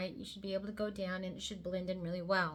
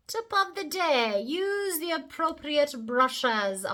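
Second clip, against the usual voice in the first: announcer voice; 7 semitones higher, 7 dB louder, much slower than usual. Acted. Introducing the second tip.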